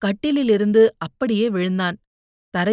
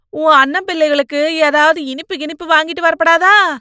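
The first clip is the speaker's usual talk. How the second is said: higher, louder, ranting mother; loud scolding; 6 semitones higher, 8 dB louder than usual. Acted. Mother rants about the ghost. (மகளிடம் சத்தமாக திட்டித் தீர்க்கும் அம்மா)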